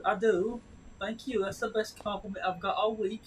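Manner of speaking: high-pitched, playful retold thanks; mock proud retort. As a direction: act out a cheeky grateful comeback. Own retort in retold banter.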